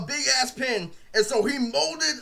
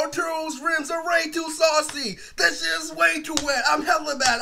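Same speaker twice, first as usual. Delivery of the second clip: high, sustained singsong complaint; jealous, mad protest; 5 semitones higher than usual. Retold or imitated. Jealous over Arturo's rims, high mock-angry protest. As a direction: keep it high and whining in mock outrage. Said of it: "I was jealous as fuck".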